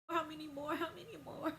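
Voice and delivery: high, whiny mock begging; lightly voiced sobbing cousin; mock pleading. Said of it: "She was like".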